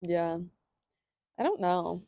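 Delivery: ordinary delivery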